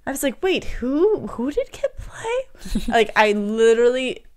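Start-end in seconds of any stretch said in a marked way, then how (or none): 0.1-2.8 high-pitched, baffled reaction; retold disbelief, pitch climbing high on the question; thrown back. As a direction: replay being caught off guard. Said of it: "really thrown back when I read that".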